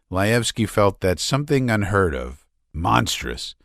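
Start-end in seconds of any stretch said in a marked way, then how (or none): none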